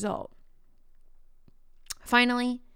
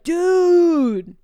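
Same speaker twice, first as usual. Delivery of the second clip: a loud, higher, exasperated "Dude"; mock confrontational; 8 semitones higher, 11 dB louder than usual. Shown as a demonstration. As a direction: fire it off like a fed-up challenge. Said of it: "I didn't say, like".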